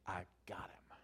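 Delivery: the devil, whisper building to a loud slow crowing; sly devil crowing over his catch; gloating devil. Retold or imitated. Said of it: "He's like".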